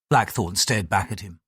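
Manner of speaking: usual speaking voice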